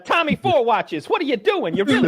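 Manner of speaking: a hint of a wiseguy boss; mobster voice. Mobster scolding flashy member.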